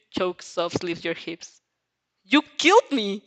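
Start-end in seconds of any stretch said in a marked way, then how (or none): 2.2-3.2 high, loud accusing yell; outraged shout. Acted. Girl yelling at the mafia man who killed her, outraged. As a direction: yell it at him, betrayed.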